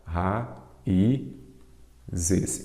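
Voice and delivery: "Ra, i, zes" in three separate beats; syllable by syllable. Shown as a demonstration. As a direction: split raízes with a pause after each of Ra, i, zes. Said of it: "Vamos separar". ("Ra, i, zes" dito pausadamente, sílaba por sílaba)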